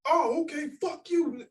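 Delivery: high, mocking "oh, okay" twist; mock taunting reply. Pool player mocking the man at the bar, high sneering mock reply. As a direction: sneer it back, pitched well up. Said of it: "They, they start walking towards me".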